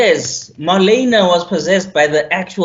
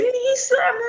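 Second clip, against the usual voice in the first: put-on high cute anime girl; 6 dB quieter than usual.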